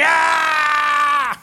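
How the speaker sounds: very high, loud held shriek; mock squeal of a running toddler; imitated child's scream. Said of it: "She's like".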